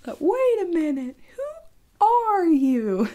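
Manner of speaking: very high, drawn-out put-on voice; imitating a known clip. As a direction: stretch it out slowly in comic high-pitched disbelief. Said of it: "you guys know that thing".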